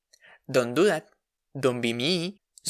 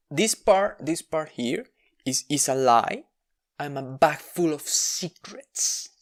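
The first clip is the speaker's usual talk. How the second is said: ordinary delivery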